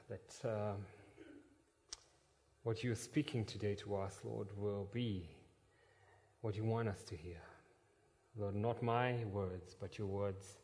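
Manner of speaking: hushed reverent prayer. Real, not acted. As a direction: pray quietly to God. Opening prayer.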